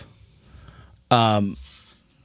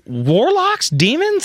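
baffled townsfolk; 12 semitones higher, much slower than usual. Retold or imitated. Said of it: "the people going like".

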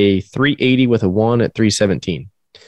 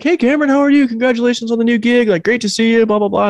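much higher, chirpy greeting voice; cheery official; 13 semitones higher than usual. Retold or imitated. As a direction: imitate a breezy friendly official. Quoting official's cheery small talk.

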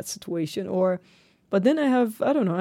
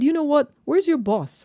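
higher, louder retold demand; angry demand; 9 semitones higher, 4 dB louder, faster than usual. To the officer at the roadside, an angry retold demand. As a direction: snap back at the officer, asking for his boss.

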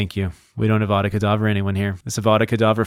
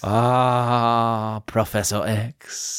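slow, relishing mock French accent; much slower than usual. Co-host shouting out a donor, slow French accent. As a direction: linger on it in hammed-up French. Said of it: "In a French accent".

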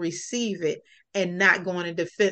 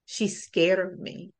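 retold tearful dad; 6 dB quieter than usual. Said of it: "he started to cry".

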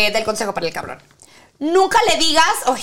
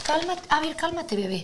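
muy bajito, condescendiente; tono de burla; 11 dB quieter than usual. Retold or imitated. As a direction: imita al que calma a su pareja como a una bebé. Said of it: "es el tono en el que lo hacen". (burla en voz muy suave)

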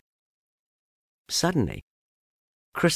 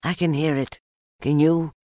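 high, nervous little piglet voice; anxious; 6 semitones higher, 3 dB louder than usual. A narrator's reading. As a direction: squeak it uneasily, rising on the question. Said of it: "said Piglet anxiously".

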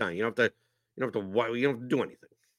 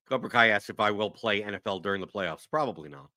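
ordinary talk

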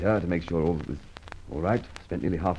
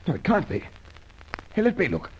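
frantic disbelief; 12 semitones higher than usual. Acted. Detective pushing in to see the empty safe, frantic. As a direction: refuse to believe it.

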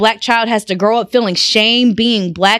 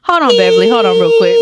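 much higher, louder cry of Hold on; excited cut-in; 12 semitones higher, 4 dB louder than usual. Real, not acted. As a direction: jump in loud and high, half laughing. Laughing, calling for a pause.

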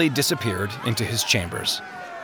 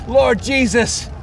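high, drawn-out cry to heaven; impassioned high-pitched cry; 11 semitones higher than usual. A narrator's reading. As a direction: cry out in agony to the Lord. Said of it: "he cried out".

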